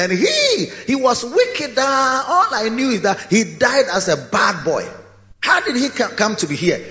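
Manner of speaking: high, indignant scandalised voice; gossiping church elder. Acted. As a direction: sneer at the wicked boy who made it. Heaven folk mock newcomer.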